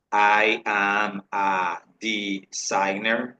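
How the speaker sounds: very slow, word-by-word demonstration; slow model sentence. Shown as a demonstration. Teacher models a job sentence for students, very slowly. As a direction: stretch each word and syllable for learners.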